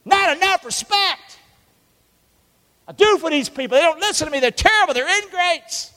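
high, loud complaining voice; voicing Moses. Preacher voicing Moses griping about his people, high and loud. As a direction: gripe like a fed-up leader.

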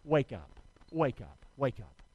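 low, soft repeated wake up; fading low murmur of wake up; quiet low call. Shown as a demonstration. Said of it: "Say it with me".

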